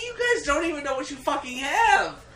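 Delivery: high, loud exasperated voice; exasperated rant. Retold or imitated. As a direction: rant in mock frustration. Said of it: "I'm like".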